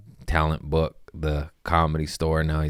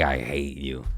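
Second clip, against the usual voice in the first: little hate; 6 semitones lower, 6 dB quieter than usual. Shown as a demonstration. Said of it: "I said it in a little".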